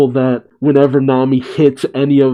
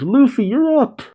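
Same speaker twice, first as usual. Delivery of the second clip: high, delighted mock exclamation; excited greeting; 12 semitones higher than usual. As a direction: pitch it up like an overjoyed friend. Acted glad greeting on waking.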